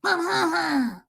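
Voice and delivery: high, drawn-out wordless howl; wordless scary howl. Shown as a demonstration. Showing how it is scarier wordless, mock howl. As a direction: howl it with no words, hammed-up. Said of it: "do it without the words".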